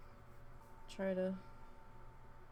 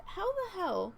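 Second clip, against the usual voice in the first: exasperated; 4 semitones higher than usual. Retold or imitated. Asking her husband about his lazy mom, exasperated. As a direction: let the disbelief spill out.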